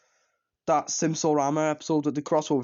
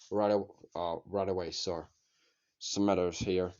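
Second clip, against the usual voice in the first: Smithers; 7 semitones lower, 6 dB quieter than usual. Shown as a demonstration. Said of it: "I can do an impression of them".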